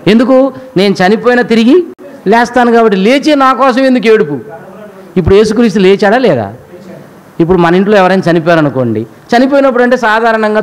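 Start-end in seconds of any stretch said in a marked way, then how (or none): none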